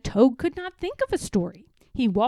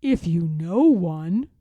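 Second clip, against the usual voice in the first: lower, louder and slow; Toad's voice; 6 semitones lower, 6 dB louder than usual. Acted. Toad doubting Frog knows a story, low and slow. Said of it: "said Toad".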